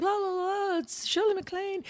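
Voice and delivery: high-pitched, sing-song gushing; mock starstruck fawning. Staff fussing as the star arrives, mock starstruck. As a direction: imitate a crowd cooing over a celebrity.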